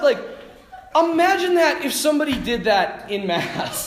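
normal talking voice

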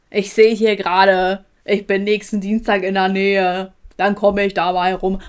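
slow, loud, laboured imitation; mega slow phone talker. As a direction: spell it out slowly and loudly down the phone. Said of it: "mega langsam am Telefon".

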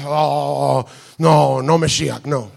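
low, groaning mock protest; mock offended. Imitating offended listeners at the prayer, mock groaning protest. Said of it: "están los judíos ofendidos".

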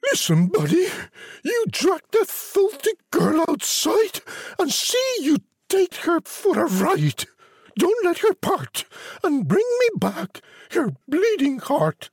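a shrieking wicked queen; shouting queen. A narrator's reading. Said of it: "shouted at him".

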